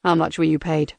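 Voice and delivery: cold robotic interrogator. Acted.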